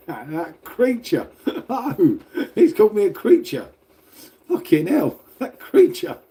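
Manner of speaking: high, laughing exclamations; helpless laughter breaking through the words; laughing in disbelief. Real, not acted. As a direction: crack up while repeating the insult. Amused at being called creature.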